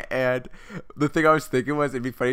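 usual speaking voice

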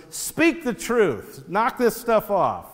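high, exasperated retort; piping back. Acted. King piping back at the sarcastic prophet, exasperated. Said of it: "pipes back and he goes".

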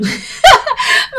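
high giggling laugh; burst of laughter. Real, not acted. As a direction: laugh out loud. Laughs at own cave joke.

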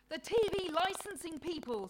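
loud, high, cross outburst. A narrator's reading. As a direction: complain crossly as Mother Nature. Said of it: "says Mother Nature crossly".

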